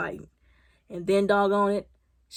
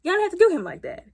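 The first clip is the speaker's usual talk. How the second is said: higher, faster repeat; 8 semitones higher, faster than usual. Retold or imitated. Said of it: "And I was like".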